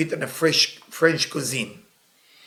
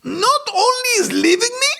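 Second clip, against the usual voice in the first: high, loud indignant wail; imitating the friend's high outraged cry; outraged woman; 4 dB louder, slower than usual. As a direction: play the furious jilted woman. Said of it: "I said".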